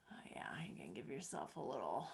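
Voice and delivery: quick soft whisper. Real, not acted. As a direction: hurry it through in a whisper. Whispered cue during massage.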